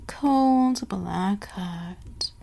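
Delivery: drawn out slowly and softly; slow menace. Acted. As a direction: savour each word, very slow. Villain savoring her dark line.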